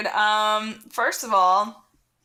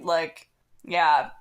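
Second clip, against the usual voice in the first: ordinary talk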